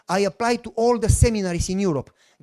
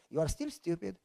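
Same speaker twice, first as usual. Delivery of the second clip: much softer, dry divine verdict; mock God aside; 4 semitones lower, 12 dB quieter than usual. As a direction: drop to a wry murmur. God's dry comment.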